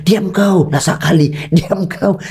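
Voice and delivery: membentak anak, nada tinggi; bentakan orang tua. Retold or imitated. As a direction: hardikan tiruan yang setengah bercanda. (orang tua menghardik anak yang aktif, bentakan tiruan)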